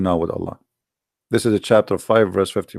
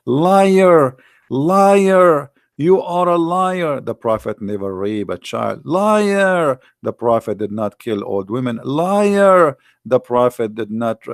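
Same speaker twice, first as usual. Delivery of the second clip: high, loud bursts of "liar" between slower protests; imitating Muslims shouting him down; heckling crowd; 4 semitones higher, 4 dB louder, much slower than usual. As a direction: jeer like an angry chat room. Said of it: "they were saying".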